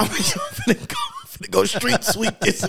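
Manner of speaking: high mocking quote of a shooter. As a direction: squeak it out, mocking.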